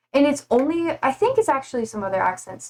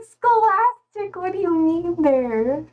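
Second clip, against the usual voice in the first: high, stretched-out puzzled question; retold disbelief, starting high and slowly coming down; baffled reaction; 7 semitones higher, slower than usual. Streamer recalling her confusion over a term, baffled.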